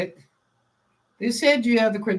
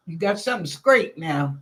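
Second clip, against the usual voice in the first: ordinary delivery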